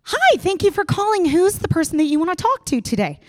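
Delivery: bright, high receptionist greeting; chirpy customer service pickup; high sing-song office voice; office phone voice. Retold or imitated. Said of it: "and I'm like".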